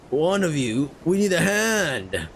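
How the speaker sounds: drawn-out Australian sailor's call; Australian accent. Acted. Sailor asking deckhands for help, drawn-out Australian accent. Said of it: "Oh, he's Australian".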